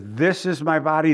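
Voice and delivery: "This" pushed out ahead of the rest; stress on this. Shown as a demonstration. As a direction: lean on the word "This" to start the verse. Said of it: "they emphasize the this".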